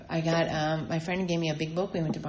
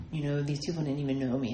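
ordinary talk, nothing marked

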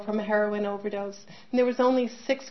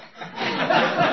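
breathy high laugh; a delighted little laugh; 8 semitones higher, 4 dB louder than usual. Real, not acted. Mother laughing at her daughter's blunt advice, a high breathy laugh.

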